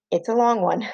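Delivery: normal talking voice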